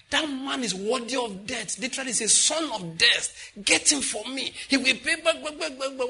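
high, indignant tirade; outraged shouting. Acted. David condemning the man in Nathan's story, outraged shouting. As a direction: rant it high, breaking into rapid repeats at the end. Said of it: "When he finished shouting".

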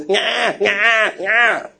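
high, whiny newborn wail; imitated baby cry. Preacher mimics how his listeners cried at birth, imitated baby cry. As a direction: mimic an ordinary baby's crying. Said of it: "when you were born, it was".

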